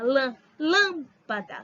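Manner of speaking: LÂM in "lâmpada" isolated, then hit hard; "lâmpada" with a strong first syllable; stressed first syllable. Shown as a demonstration. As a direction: punch the LÂM of "lâmpada", slowly. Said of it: "A forte é a antepenúltima".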